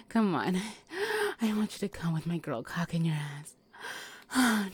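ordinary talk

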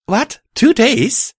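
high, loud shocked cry; shocked shout. A narrator's reading. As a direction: cry out in disbelief. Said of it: "I cried out".

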